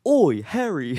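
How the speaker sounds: high, loud mock-British call; hammed-up British shout; British accent. Shown as a demonstration. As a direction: put on a comic British accent, calling out. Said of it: "like, a British accent".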